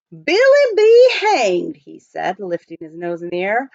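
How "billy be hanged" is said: much higher, louder, slow exclamation; Mountain Jim surprised. A narrator's reading. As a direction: blurt it out in sudden delight. Mountain Jim catches a smell.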